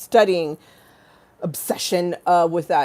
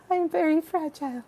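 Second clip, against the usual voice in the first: mock fragile voice; 11 semitones higher than usual. Quoting her private self after camera.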